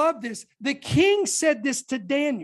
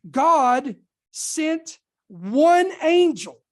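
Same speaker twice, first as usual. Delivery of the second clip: slow, louder, stretched out, weight on one; slow emphatic proclamation; 5 dB louder, much slower than usual. Acted. Preacher to congregation about the angel, slow louder emphasis. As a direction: hammer home God sent one angel, slowly. Climax of the Assyrian story.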